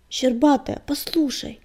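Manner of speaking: usual speaking voice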